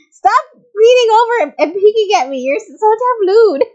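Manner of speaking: high, loud indignant outburst; flustered scolding. Acted. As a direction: scold the peeker in a high, drawn-out yell. Flustered scolding of a peeking character.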